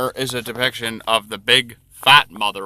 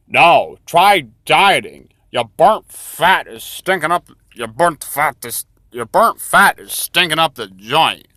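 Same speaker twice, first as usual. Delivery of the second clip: loud, slow, badly faked German accent; doctor scolding the patient in a poor German accent; 3 semitones higher, 10 dB louder than usual. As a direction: bark it in a failed German accent, louder. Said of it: "I cannot do a German accent at all".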